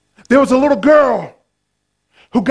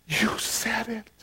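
breathless unvoiced whisper; 2 semitones lower, 14 dB quieter, slower than usual. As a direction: whisper it in awe.

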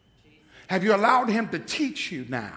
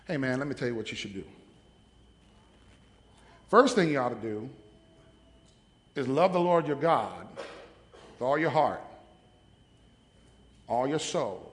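Steve Yates voice; 5 semitones lower than usual. Retold or imitated. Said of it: "in the Steve Yates voice".

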